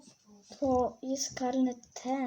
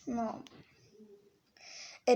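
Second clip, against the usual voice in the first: normal talking voice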